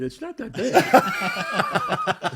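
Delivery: high, slow laughing coax; mock-coaxing wife laughing. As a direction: stretch it out through a laugh. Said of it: "my wife said".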